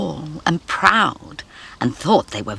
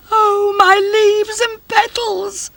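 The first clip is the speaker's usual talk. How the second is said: very high, loud, slow wail; shout; 13 semitones higher, 4 dB louder, slower than usual. A narrator's reading. Lily being picked by Missus, slow, very high loud wail. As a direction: stretch it out as she's being picked. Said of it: "shouted the lily on the left".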